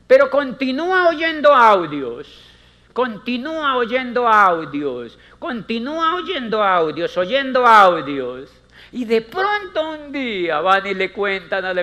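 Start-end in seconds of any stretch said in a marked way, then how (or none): 0.1-8.5 loud and slowing on oyendo; emphatic repetition. Real, not acted. Speaker to audience, stressing "oyendo audios" loudly and slowly. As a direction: hammer the point home. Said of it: "repeats for emphasis".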